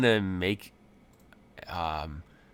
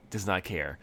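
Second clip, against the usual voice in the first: ordinary delivery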